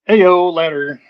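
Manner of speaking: excited call. Real, not acted. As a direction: call it out with energy. Calling out excitedly.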